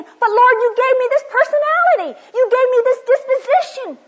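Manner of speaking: high, loud, pleading voice of a frustrated woman; pleading prayer. Retold or imitated. Woman at a seminar retelling her prayer to God, high loud pleading. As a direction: cry out to the Lord, high and loud, why am I made this way.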